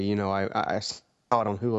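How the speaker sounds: ordinary talk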